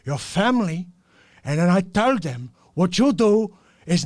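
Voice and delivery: loud threatening Cuban gangster; angry Scarface impression. Scarface scolding the barista, loud threat. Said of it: "Scarface Al Pacino".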